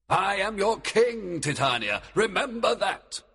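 a king reminding his queen who rules; stern command. A narrator's reading. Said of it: "he commanded in a stern voice".

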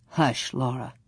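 low, hushed stern father; quietly but firmly silence the child. A narrator's reading. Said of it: "Pa told her sternly".

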